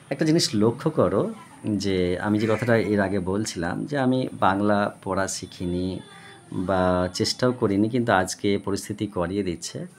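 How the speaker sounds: normal talking voice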